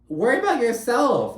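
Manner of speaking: high, loud sassy retort; telling off. Real, not acted. Vlogger telling Brynn off, loud high retort.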